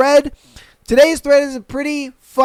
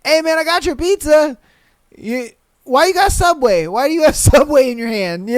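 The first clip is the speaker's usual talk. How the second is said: high, puzzled delivery guy voice; pizza guy voice; 6 semitones higher than usual. Retold or imitated. Pizza guy at the door questions the stoner, high and puzzled. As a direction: play a confused pizza guy at the door, noticeably higher. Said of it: "the pizza guy is just standing there".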